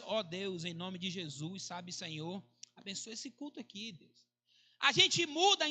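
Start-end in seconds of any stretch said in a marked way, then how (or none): 0.0-4.2 low, soft, casual murmur of a prayer; mild routine prayer. Acted. Preacher imitates an everyday prayer before desperation hits, low and soft. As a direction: pray quietly and low, as if out of habit. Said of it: "mudam de entonação. Você já não ora mais".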